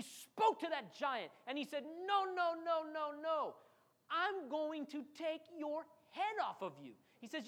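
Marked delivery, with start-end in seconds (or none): 1.8-6.9 high insistent refusal; defiant David. Acted. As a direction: push back hard on the giant. David defying Goliath, acted.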